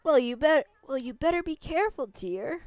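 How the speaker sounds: lightly voiced kindly village grandma; a gentle old lady warning the traveler. Old grandma greeting the wolf and its rider, lightly voiced.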